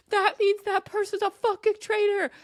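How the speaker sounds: high, loud, insistent yell; ranting at full pitch; exasperated shout. Real, not acted. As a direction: shout the obvious at them. Ranting at players missing obvious clue.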